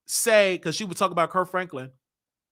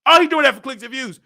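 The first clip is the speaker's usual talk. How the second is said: mock critic; 6 semitones higher, 9 dB louder, faster than usual. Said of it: "y'all gonna drag me".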